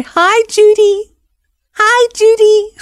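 put-on high, drawn-out singsong child voice; little kids' greeting. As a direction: imitate small children piping up for attention. Toddlers pulling on a skirt, voiced.

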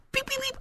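high, soft beeping; tiny high-pitched "beep, beep"; imitated censor beep. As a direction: squeak out a mock bleep. Censors own threat with beeps.

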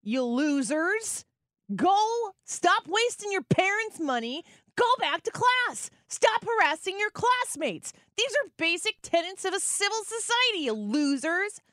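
high-pitched exasperated rant; indignant telling-off, hammed up; mock scolding.

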